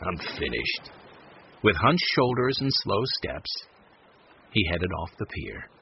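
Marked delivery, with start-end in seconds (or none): 0.0-0.9 low, soft resignation; dejected and finished; defeated. A narrator's reading. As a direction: sink into a low, quiet voice of giving up. Charlie quits, shoulders hunched.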